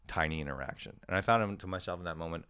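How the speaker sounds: usual speaking voice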